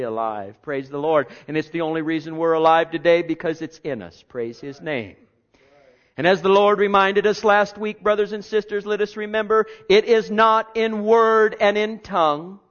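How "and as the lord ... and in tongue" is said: loud, higher fervent preaching. Real, not acted. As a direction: preach it out to the congregation. Preaching at full force.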